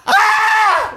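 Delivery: high scream with a laugh; startled scream. Retold or imitated. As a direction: scream like you woke in terror. Wife startled awake.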